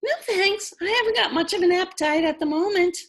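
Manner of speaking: put-on high, quick girlish voice, breezy; young girl Lulu. A narrator's reading. Lulu turns down food from Missus Griffin, high and quick. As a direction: chirp it like a carefree young girl.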